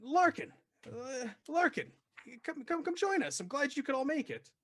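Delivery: high greeting voice. Acted. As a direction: call each name out high and bright. GM voices man greeting party.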